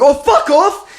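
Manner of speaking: high, loud indignant outburst; hammed-up outrage; mock offended Kiwi. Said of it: "if you are a Kiwi".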